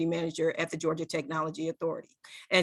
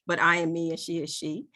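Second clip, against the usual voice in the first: ordinary talk, nothing marked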